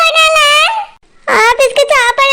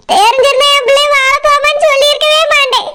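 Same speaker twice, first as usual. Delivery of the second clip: normal talking voice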